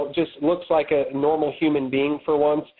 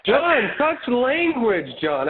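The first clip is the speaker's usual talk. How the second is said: higher, slow, drawn-out reproach; mock scolding; 5 semitones higher, much slower than usual. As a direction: playfully chide him for swearing. Mock scolding of Madden's swearing.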